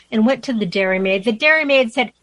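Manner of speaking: measured, lilting storybook verse; poem recitation. Acted. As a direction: read the rhyme theatrically, giving each character a voice. Reads a poem aloud.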